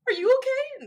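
high gasping concern. Retold or imitated. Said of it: "I was like".

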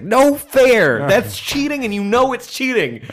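high, loud mock outrage; hammed-up, high-pitched complaint; outraged protest. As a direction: cry foul like an indignant cartoon hero. Acts character's outraged protest.

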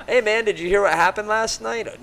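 high quote with laughter through it; laughing quote. Retold or imitated. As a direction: laugh while asking the question. Quoting the guys the next morning.

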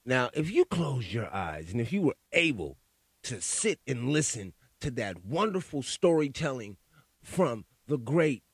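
usual speaking voice

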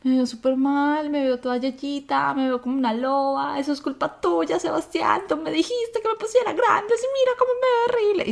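high, whining mock complaint that climbs steeply; high whiny mock lament. Retelling her whiny post-surgery complaint.

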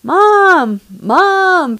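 calling Mom across the house, loud and high; child calling out from the next room; mock kid calling out. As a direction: imitate a child hollering for mom. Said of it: "he was just".

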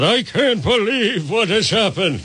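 a hint of old Salty lamenting, drawn out; voicing Salty. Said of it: "Poor Salty in the dream said".

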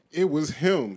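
loud accusing call. Retold or imitated. Imagined moviegoer calling out the culprit, loud. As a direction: point him out, loud.

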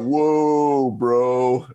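mock gassed-up voice, vowels stretched out; nitrous voice. Partygoer reacting after a hit of nitrous, imitated nitrous voice. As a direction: imitate someone slowed down by nitrous. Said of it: "your voice goes deep".

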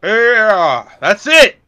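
loud, high mocking yell; mock grunge yell. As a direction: belt it like a grunge singer.

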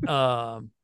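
ordinary talk

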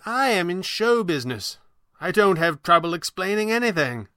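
high, grand, theatrical boast; high-pitched magician, self-assured; old showman. Acted. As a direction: declare it with stage flourish. Voices Falcone.

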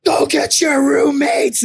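high, slow shouted demand; mock wild shout. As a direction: yell it out wildly, drawn out.

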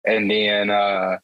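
ordinary delivery

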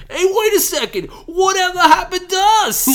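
high, loud puzzled cry; Rosencrantz. Retold or imitated. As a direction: call out like a bewildered sidekick. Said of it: "Rosencrantz being like".

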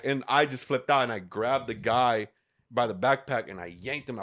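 usual speaking voice

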